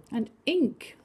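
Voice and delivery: ordinary delivery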